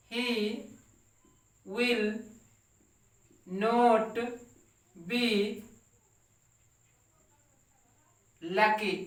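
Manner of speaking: each word set apart by long pauses; slow word-by-word dictation. Shown as a demonstration. Teacher dictating to the class, word by word, slowly. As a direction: dictate it one word at a time for students to copy. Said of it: "teacher dictating word by word".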